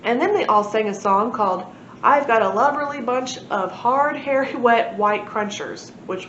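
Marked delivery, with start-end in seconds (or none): none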